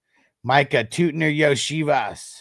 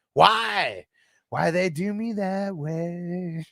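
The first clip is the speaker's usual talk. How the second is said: mock lament; 7 semitones higher, much slower than usual. Real, not acted. Lamenting tough lineup choice.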